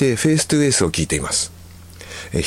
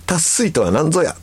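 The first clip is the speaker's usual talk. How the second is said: higher-pitched playful demand; mock interrogation; 7 semitones higher than usual. Wife questioning husband about dialect word, mock demanding. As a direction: demand an explanation, hammed up. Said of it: "と問いただしたところ".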